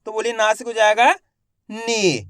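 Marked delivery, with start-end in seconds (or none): 1.7-2.2 नी with a long-held ee; matra pronunciation demo. Shown as a demonstration. Teacher showing pupils the matra sound, नी drawn out long. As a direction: hold the vowel of नी for learners. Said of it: "इस मात्रा का उच्चारण किया जाता है".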